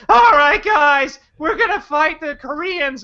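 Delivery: rally the troops in mock; mock hero rallying speech. Mocking the movie hero rallying the group. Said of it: "He's like".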